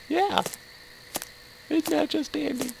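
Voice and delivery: high, soft mock-cheery sarcasm; sweet-voiced sarcasm; sarcastic. Real, not acted. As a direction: chirp it with dripping irony. Sarcastic about scary game.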